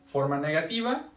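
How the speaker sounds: ordinary delivery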